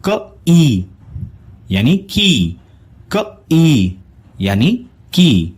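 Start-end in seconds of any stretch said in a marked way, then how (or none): 0.1-0.8 क ई split into two slow separate sounds; sound-by-sound phonics split; sound split apart. Shown as a demonstration. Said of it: "इसका sound बनेगा". 3.1-4.0 क ई split into two slow separate sounds; sound-by-sound phonics split; sound split apart. Shown as a demonstration. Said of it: "इसका sound बनेगा".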